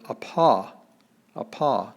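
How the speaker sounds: apa, said slowly with stress on the second syllable; careful Greek apa. Shown as a demonstration. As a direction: say apa as ah-PAH. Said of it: "in Greek, the word".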